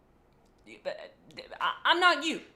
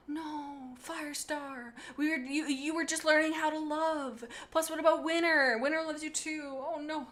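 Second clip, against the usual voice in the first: grieving; 4 semitones higher than usual. Acted. Grieving over dead Firestar.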